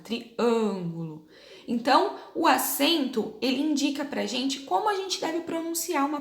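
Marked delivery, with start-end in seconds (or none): 0.0-1.3 Triângulo slowly, the â closed and nasal. Shown as a demonstration. Teacher showing pupils the closed â in Triângulo. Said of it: "Boquinha mais fechada".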